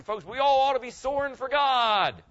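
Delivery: rousing pulpit call; preaching loud. Real, not acted. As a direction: lift it up and exhort the crowd. Preacher exhorting the congregation.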